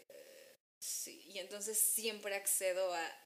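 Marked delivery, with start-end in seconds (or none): 0.1-1.2 resigned mumble. Retold or imitated. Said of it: "es como de".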